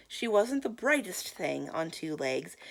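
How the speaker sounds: ordinary delivery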